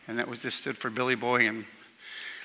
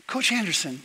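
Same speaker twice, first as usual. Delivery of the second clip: put-on a higher, earnest boy voice, slowing on the Queen; innocent kid question; 6 semitones higher than usual. Young Bill asking the coach a silly question in a kid voice. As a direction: ask it wide-eyed and serious, like a little kid. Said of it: "Bill says".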